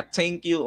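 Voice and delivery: "Thank you" said as "tenk you"; TH mispronounced as T. Retold or imitated. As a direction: imitate students saying "Thank you" with a hard T. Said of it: "napopronounce na lang nila yan as".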